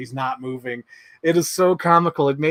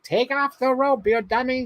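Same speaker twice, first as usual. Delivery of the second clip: high-pitched mock heckle at the screen; mom heckling; 10 semitones higher than usual. Imitating mom at the cinema scolding the film's hero, mock heckling. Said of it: "like, heckling the movie".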